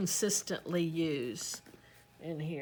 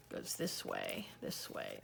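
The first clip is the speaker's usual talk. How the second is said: low, soft mutter to herself; muttered to self; 11 dB quieter than usual. Real, not acted. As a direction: count it off under your breath. Muttering while folding pages.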